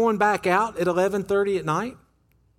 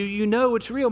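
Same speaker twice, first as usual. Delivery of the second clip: normal talking voice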